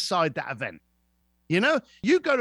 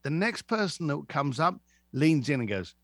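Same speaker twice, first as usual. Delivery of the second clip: normal talking voice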